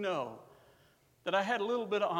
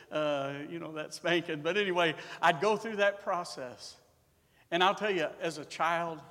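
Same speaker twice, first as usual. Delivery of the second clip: normal talking voice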